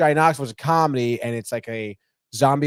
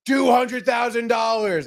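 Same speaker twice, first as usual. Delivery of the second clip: high, drawn-out auction shout; shouted bid; 9 semitones higher, slower than usual. Retold or imitated. Impulsive bidder caught up in the golf auction, shouting. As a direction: call it out high across the room, stretching each word.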